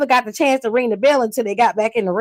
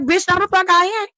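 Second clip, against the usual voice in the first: much higher, defiant snap back; sassy mock retort; 7 semitones higher than usual. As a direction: fire it back with pitched-up attitude. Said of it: "She was like".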